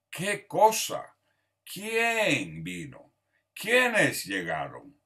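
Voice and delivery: slow, drawn-out model questions; slow example questions. Shown as a demonstration. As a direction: read each question slowly for the class. Teacher reading example questions slowly. (preguntas lentas de ejemplo)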